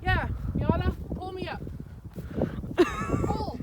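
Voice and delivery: high strained call while pulled; tug of war. Real, not acted. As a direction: urge the dog to pull, high. Dog pulling her uphill.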